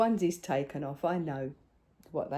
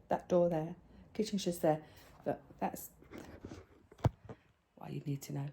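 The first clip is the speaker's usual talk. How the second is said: ordinary talk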